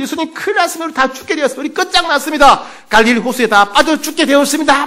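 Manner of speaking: high-pitched urgent alarm, acted; panicked plea. Disciples shake Jesus awake in the storm, panicked. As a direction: cry out to rouse a sleeper. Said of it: "막 흔들어 깨웁니다".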